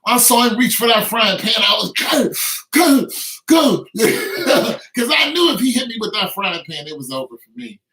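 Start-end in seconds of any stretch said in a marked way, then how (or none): 1.9-3.9 high, rising urgent chant. Retold or imitated. Seeing the frying pan coming, urgent chant. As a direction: push yourself on, frantic. Urging himself in fight.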